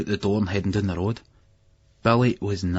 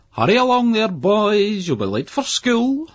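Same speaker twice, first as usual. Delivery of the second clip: much higher, louder, slow mock toff; high posh voice; 14 semitones higher, 6 dB louder, slower than usual. A narrator's reading. Older brother mocking the boys from a lorry, high posh voice. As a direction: put on a plummy teacherly voice. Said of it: "in a high, put-on posh voice".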